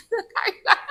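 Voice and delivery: high laughing answer; laughing reply. Real, not acted. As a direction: laugh the words out. (jawaban sambil tertawa tinggi)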